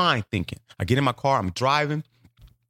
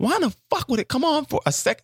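high baffled outrage. Retold or imitated. Baffled at the radio.

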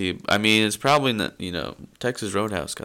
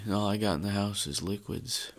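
ordinary delivery

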